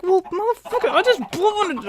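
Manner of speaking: high-pitched mock tantrum; mock outraged rant. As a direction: squeal it like a cartoon of fury.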